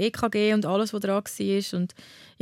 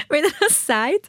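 laughing through the words, higher-pitched; 6 semitones higher, 4 dB louder, slower than usual. Real, not acted. Patient amused by her father's bedside remark, laughing.

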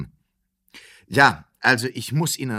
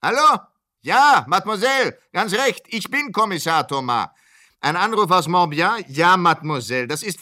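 high and loud over a crackling line; shouting into the phone; 5 semitones higher, 5 dB louder than usual. Acted. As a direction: make yourself heard on a bad phone line. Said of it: "Ich schreie laut?".

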